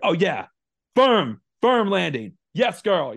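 high, loud cheering from the stands; excited cheering. Retold or imitated. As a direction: cheer her on like a hyped parent. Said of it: "I'm like".

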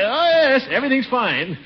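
hasty cover-up answer; flustered. Acted. Caught by landlady, covering up.

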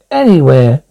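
usual speaking voice

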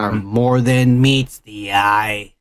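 low, slow, drawn-out movie line; imitated robot hero. Fan quoting the movie robot's big line, slow and low. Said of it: "he goes".

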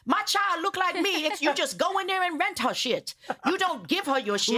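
the Trinidadian lady explaining surrogacy, high and matter-of-fact; Trinidadian accent. Retold or imitated. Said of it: "That was an amazing accent".

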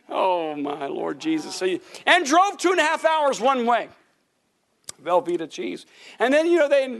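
ordinary delivery

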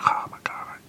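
whispered gasp of delight; breathless whisper. Pretend. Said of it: "You'll be like".

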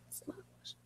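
breathy whispered song title. Real, not acted. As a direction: whisper it to yourself.

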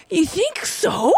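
high, slow innocent you think so; playing dumb. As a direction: fake innocence, slowly and high. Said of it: "I totally knew he was right".

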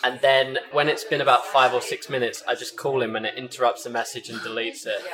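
usual speaking voice

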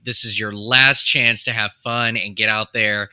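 normal talking voice

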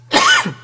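sudden choking cough; coughing fit. Real, not acted. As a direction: cough hard, something went down wrong. Went down the wrong pipe.

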